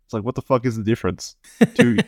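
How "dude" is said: high, loud disbelieving cry; a high, loud exasperated call to a co-host; exasperated dude. Real, not acted. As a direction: throw up your hands at the absurdity. Exasperated at needless lies.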